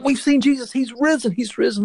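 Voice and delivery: high, bursting with joy; retelling the disciples' shout of joy; excited news. Retold or imitated. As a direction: blurt it out breathless with joy. Disciples' joyful news retold.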